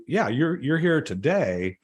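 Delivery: ordinary talk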